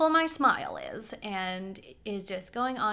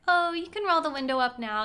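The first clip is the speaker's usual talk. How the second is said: high, louder, breezy offer; over-polite offer; 7 semitones higher, 6 dB louder than usual. Retold or imitated. Passenger on interstate giving driver an out, over-polite. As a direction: offer it brightly to placate.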